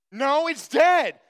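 playacted brother flatly refusing to believe it; mock insistent denial. Retold argument between the brothers.

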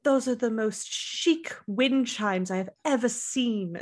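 slow, theatrical socialite; drawn-out, affected admiration; Dorit. Retold or imitated. Host mocking Dorit's theatrical act, slow and affected. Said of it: "putting on a play to no one".

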